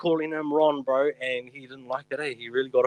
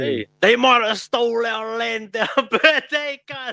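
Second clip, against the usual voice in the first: mock battle cry; 7 semitones higher, 5 dB louder, slower than usual. Mock rousing speech, laughing.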